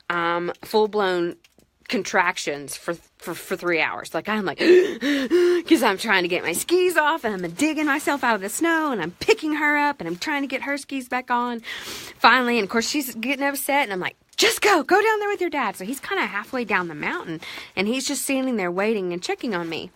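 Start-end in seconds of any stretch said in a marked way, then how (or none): 4.6-5.6 sharp, loud panicked gasp; a big gasp, high and loud. Retold or imitated. Struggling in deep snow. 14.4-15.8 exasperated urging. Retold or imitated. Telling upset daughter to leave.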